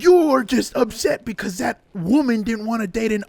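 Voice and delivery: loud, high-pitched psychotic shouting; mock courtroom outburst. Ace Attorney murderer cornered in court, hammed-up psychotic shout. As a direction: yell it like a cornered villain in a video game. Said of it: "they're completely psychotic".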